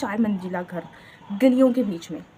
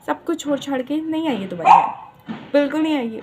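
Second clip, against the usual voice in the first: loud, defiant insistence, higher; rebel kid attitude; 4 semitones higher, 7 dB louder than usual. Acted. Vlogger defiantly denying to viewers she ran away, rebel kid attitude. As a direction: fling it back like a rebellious teen. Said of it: "rebel kid की आत्मा घुस गई है मेरे अंदर".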